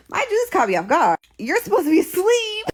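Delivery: indignant yell climbing very high; outraged. Retold or imitated. Confronting boyfriend caught out on a date, shrill outrage. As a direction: protest loudly, pitch shooting up. Acted outburst at lying boyfriend.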